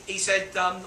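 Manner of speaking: usual speaking voice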